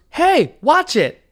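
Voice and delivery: high, loud, sharp 'watch it'; imitating an outraged passerby calling out; mock indignant yell. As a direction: snap it out like an affronted stranger. Quoting the woman who bumped him.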